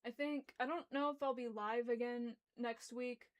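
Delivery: ordinary delivery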